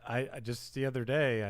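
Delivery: ordinary talk, nothing marked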